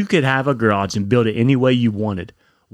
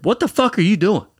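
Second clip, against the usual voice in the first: higher-pitched retold angry challenge; pissed off; 8 semitones higher than usual. Man confronting the driver who nearly hit them, pissed off. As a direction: replay an indignant demand, pitched up. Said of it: "I was so pissed off".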